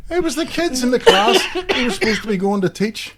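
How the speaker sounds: explaining the punchline while still laughing. Real, not acted. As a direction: let the laugh spill into the words.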